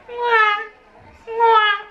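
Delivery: high imitated baby wail; mock crying. The baby starts crying in the car, mock wail. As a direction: whimper like the little one. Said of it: "empieza a llorar".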